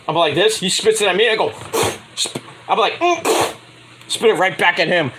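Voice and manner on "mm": high closed-mouth hum. Retold or imitated. Mimes mouthful spit back.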